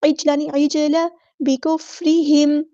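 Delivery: बिकुफ्रिहिम with audible air on fa; slow recitation. Shown as a demonstration. Teacher demonstrating breath on fa, slow. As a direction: recite बिकुफ्रिहिम slowly letting air through fa. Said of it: "फ़ा में इस तरह air flow आना चाहिए".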